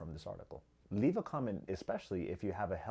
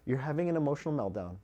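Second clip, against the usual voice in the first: higher, louder, sarcastically cheerful; mock parent praise; 6 semitones higher, 9 dB louder than usual. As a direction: send up the indulgent parent. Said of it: "is like saying".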